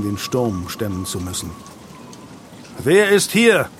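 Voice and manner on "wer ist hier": high, raised questioning call. A narrator's reading. Leader checks who reached the ruin, calling out. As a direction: call into the darkness. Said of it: "rief Losian".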